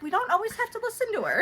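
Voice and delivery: lightly voiced scolding aside; mock mom correction. Mom telling daughter to ignore auntie, quick and high.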